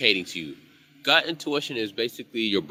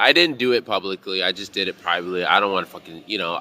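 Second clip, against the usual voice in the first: ordinary talk, nothing marked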